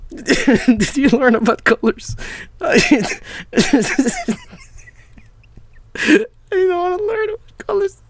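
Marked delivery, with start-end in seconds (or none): 2.6-8.0 high, whiny mock sobbing through laughter; mock whine. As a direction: whine it like a sulky kid. Laughing, mock-whining about the clip.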